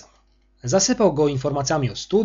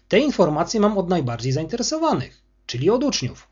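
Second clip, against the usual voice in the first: usual speaking voice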